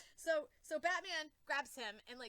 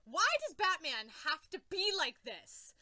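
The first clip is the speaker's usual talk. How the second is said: exasperated; 3 semitones higher, 8 dB louder than usual. Real, not acted. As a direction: plead in frustration with the comic. Exasperated complaint about Batman.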